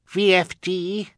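put-on baby voice. A narrator's reading. Baby Sunny's voice.

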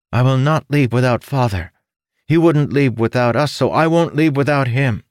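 put-on stubborn young son. Son refusing to leave without father, loud stubborn. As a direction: dig in like a stubborn child.

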